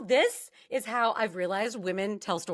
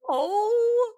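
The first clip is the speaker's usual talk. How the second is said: high loud shocked oh; hearing he grabbed her arm, shocked; shocked gasp; 12 semitones higher, 7 dB louder than usual. Real, not acted. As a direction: gasp out in horror. Reacting to grab.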